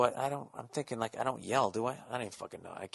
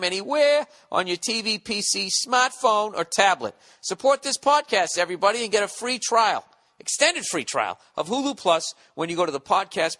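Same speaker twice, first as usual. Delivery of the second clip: upbeat, slightly higher sponsor read; 4 semitones higher than usual. Acted. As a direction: sell it like a podcast ad. Reads sponsor ad.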